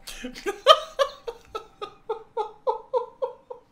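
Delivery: high shrieking laughter, out of control; helpless laughing fit. Real, not acted. Giggling fit while streaming a strategy game, shrieking laughter. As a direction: lose it completely, cackling. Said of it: "okay, I breathe".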